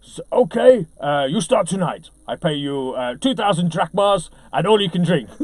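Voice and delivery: lightly put-on Greek boss offering the job; mock Greek accent. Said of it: "that's my very bad accent".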